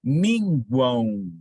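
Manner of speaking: minguão drawn out slowly, the final U clearly sounded; spoken slowly. Shown as a demonstration. Teacher sounding out minguão slowly for the class. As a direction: stretch minguão so the gliding U is heard. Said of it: "ouçam a palavra".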